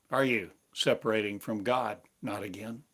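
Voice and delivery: ordinary delivery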